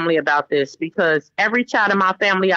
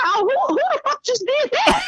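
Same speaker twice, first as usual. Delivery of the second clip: high, indignant demand without added volume; mock outraged mother. Mother to her boys after a slammed door, high mock outrage. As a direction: act out demanding the culprit, voice pitched way up.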